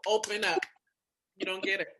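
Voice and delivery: usual speaking voice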